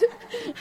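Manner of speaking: short high burst of laughter; delighted high laugh. Real, not acted. Preacher laughing with delight at God's miracle. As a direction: laugh with joy at the surprise.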